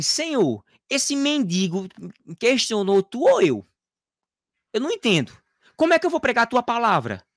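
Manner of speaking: high, impassioned appeal to God; pleading protest. Retold or imitated. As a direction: act out challenging God, urgent and high. Acted anguished complaint to God.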